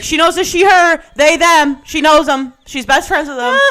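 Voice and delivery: loud, high-pitched threatened outing; mock yelling. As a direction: holler it to a whole bar in jest. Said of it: "I'm gonna yell in this bar".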